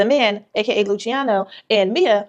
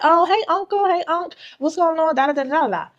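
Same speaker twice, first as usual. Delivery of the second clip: much higher, chirpy hello to her uncle; bubbly niece greeting; 7 semitones higher than usual. Retold or imitated. Mia cheerily greets her young uncle, high and chirpy. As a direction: sing out a sugary hey unc. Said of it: "and Mia's like".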